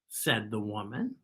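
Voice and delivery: usual speaking voice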